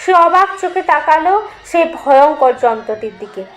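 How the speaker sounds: plain reading voice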